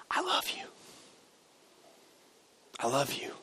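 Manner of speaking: very soft, near-whispered intimacy; barely voiced, gentle and intimate; tender whisper. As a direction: drop right down to a hush, as if close to a child. Voices God's tender words, hushed.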